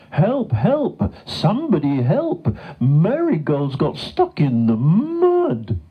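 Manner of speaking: high, urgent cow calling out; loud cry for help. A narrator's reading. Gertrude calling to anyone at the farmyard, urgent. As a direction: raise the alarm, drawing out the end. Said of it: "moo as loud as she could".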